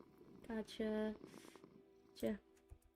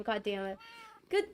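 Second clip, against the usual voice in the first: usual speaking voice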